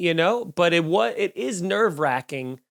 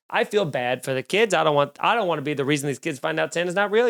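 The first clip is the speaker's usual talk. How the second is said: ordinary talk, nothing marked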